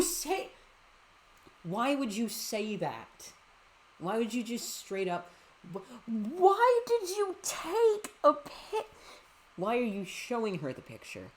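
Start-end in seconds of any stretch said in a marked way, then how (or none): 5.7-8.9 high, loud, slow disbelief; outraged squeak. Real, not acted. Outraged reaction.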